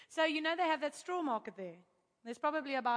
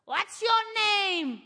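higher, louder, slow demand; imitating a shout; 7 semitones higher, 7 dB louder, much slower than usual. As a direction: bark the question like a stern landlady. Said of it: "she shouts at me".

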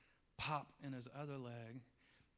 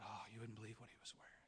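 awed whisper; 3 semitones lower, 7 dB quieter, faster than usual. Retold or imitated. Awed recounting of vision.

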